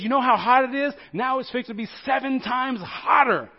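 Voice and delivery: angry king. Acted. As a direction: bellow it as the furious king. Furious king orders the oven hotter.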